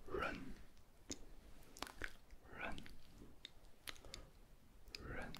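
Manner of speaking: run, Mandarin r sound; Chinese sound. Shown as a demonstration. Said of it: "des prononciations qu'on connaît pas".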